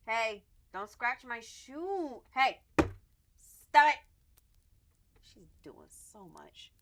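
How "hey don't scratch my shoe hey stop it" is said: drawn-out loud telling-off; loud slow scolding. Real, not acted. Stopping her cat scratching her shoe, loud slow scold. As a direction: stretch out a loud warning.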